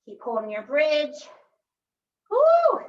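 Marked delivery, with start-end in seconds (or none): 2.3-2.8 high, loud whoop; excited cheer. Real, not acted. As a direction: cheer the class on. Instructor cheering mid-workout.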